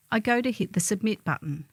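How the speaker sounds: ordinary delivery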